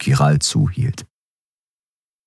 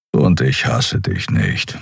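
lower, slow and gruff; low, slow Kero; 6 semitones lower, slower than usual. A narrator's reading. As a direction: say it flat and heavy. Kero's voice in story.

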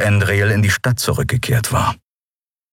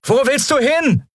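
Lien's higher voice, calling out after her. Acted. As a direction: call after a friend who's running off. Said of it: "als sie ihr nachrief".

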